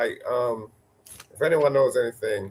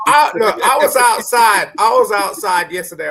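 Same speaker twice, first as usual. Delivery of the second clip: higher, louder to take the floor; 7 semitones higher, 7 dB louder than usual. Real, not acted. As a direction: push through the noise. Raising voice over laughter.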